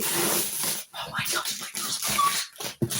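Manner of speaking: breathless whispered squeal; hushed thrilled whisper; excited whisper. Real, not acted. Whispered excitement, baby asleep.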